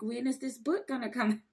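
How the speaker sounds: laughing, playful nagging; impatient fan, hammed up with a laugh; mock harassing. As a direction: pester them impatiently, half laughing. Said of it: "kinda harassing the narrator and the author".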